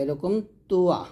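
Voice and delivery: usual speaking voice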